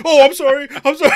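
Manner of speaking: high, loud frantic mock apology; panicked apology. Installer apologizing to the woman caller, mock panic. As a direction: gush it out through laughter.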